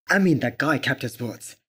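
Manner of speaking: ordinary talk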